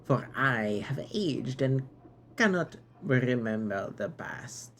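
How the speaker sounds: put-on knight's accent wandering from British to silly French; grand knightly speech in wobbly, changing put-on accents; shifting accents. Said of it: "this Bucket Knight has had four accents".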